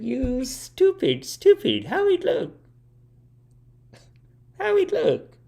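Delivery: slow, very high scolding mom impression; mad mom. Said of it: "She's so mad".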